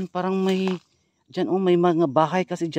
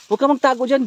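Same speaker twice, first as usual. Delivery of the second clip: loud, higher startled shouts; shouting; 10 semitones higher, 10 dB louder than usual. Real, not acted. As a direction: shout at her to come out. Spots the girl, shouts at her.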